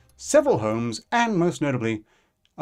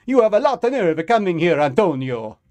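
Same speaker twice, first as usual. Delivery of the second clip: put-on loud, higher Italian accent; 4 semitones higher, 6 dB louder than usual. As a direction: threaten him in an Italian accent. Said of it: "loud Italian accent".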